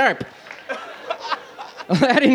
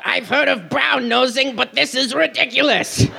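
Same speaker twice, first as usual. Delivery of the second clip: higher, cartoonish heckler; exasperated cartoon parrot, pitched up; wisecracking parrot sidekick; 5 semitones higher than usual. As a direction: squawk out the wisecrack. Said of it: "Enough, Iago".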